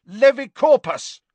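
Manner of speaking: high, loud spell cry; shouted incantation. A narrator's reading. Harry defends himself from Ron's fist, shouting. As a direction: fire the spell off sharply and loudly.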